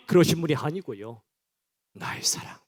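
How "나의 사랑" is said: soft intimate whisper; a God's tender whisper. Acted. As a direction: breathe it into the ear lovingly. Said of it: "귓속말".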